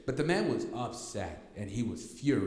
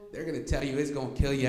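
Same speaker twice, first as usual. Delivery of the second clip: ordinary talk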